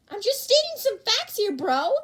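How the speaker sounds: high, loud protesting; defensive bro. Acted. Kirishima defending his joke, high and loud. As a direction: insist you're just being honest.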